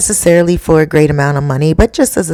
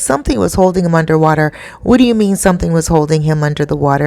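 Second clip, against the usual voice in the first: rapid-fire incredulous echo at normal pitch; retold disbelief; much faster than usual. Boyfriend questioning girlfriend's claim, retold fast disbelief. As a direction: repeat it quickly as a doubting question.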